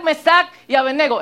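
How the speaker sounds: usual speaking voice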